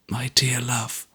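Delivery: soft whisper; whispered endearment. A narrator's reading. Richard whispering to his wife Ada at the pillow. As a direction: breathe it to her.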